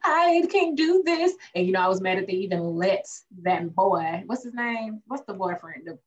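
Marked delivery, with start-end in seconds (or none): 0.0-1.3 imitating Andy crying. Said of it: "last time we left off with her".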